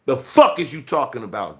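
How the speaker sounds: ordinary talk, nothing marked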